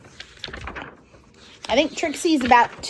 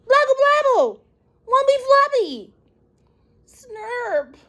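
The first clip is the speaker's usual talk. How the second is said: put-on very high, insistent baby gibberish; upset toddler babble; 13 semitones higher than usual. Toddler Trixie pleading with her daddy, very high babble. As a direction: wail the nonsense like a frustrated toddler.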